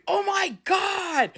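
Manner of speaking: high, drawn-out exclamation; amazed new believer. Retold or imitated. Sceptical son just became a believer, amazed high cry. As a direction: cry it out high and slow in awe. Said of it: "Max is going".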